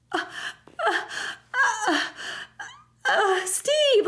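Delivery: short gasps, then a high wail; mock sobbing. Player mourning Steve's death, mock sobs. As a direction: whimper up to a hammed-up cry. Acts tearful reaction to the death.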